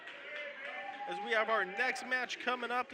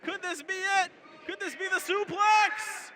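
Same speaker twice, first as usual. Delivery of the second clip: rising excitement; 7 semitones higher than usual. Real, not acted. Anticipating the finishing move.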